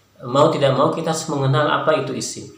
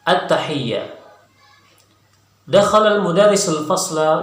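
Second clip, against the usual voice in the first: clear, slightly raised reading voice; reading text aloud; 3 semitones higher, 3 dB louder than usual. Shown as a demonstration. Teacher reads the Arabic text aloud for students to follow along. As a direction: read the passage for students to follow.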